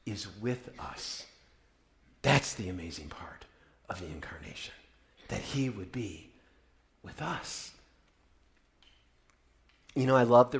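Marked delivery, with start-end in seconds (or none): 0.1-7.7 awed hush. Acted. Hushed awe after preaching.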